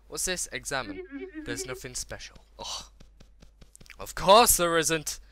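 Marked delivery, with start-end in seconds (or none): 4.0-5.2 loud, drawn-out, higher complaint; exasperated. Real, not acted. Gamer finding nothing special, exasperated. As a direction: complain it slowly and loudly.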